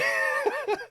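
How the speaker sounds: a short high burst of laughter. Real, not acted. Dad laughing at his daughter's matchmaking, high burst.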